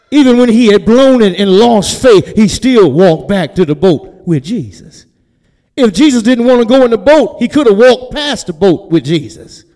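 loud, high, hard-driving sermon delivery; full-force preaching. Acted. Preacher celebrating Peter's walk back, full-force preaching. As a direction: shout the point home to the congregation.

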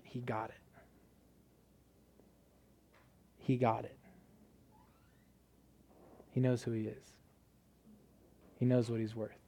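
soft, retold in a hushed tone; quietly moved. Preacher retells a tearful teammate's reply, quietly moved.

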